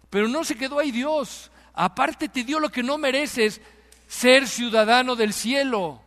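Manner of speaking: loud, high, full-force preaching. Real, not acted. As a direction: proclaim it to the congregation at full voice. (predicación a plena voz, tono alto)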